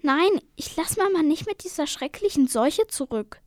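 high-pitched, insistent protest; protesting cry. Acted. Sister refusing to leave sick Mama, crying out in protest. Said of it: "schrie seine Schwester".

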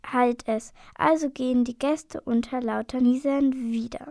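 ordinary delivery, nothing marked

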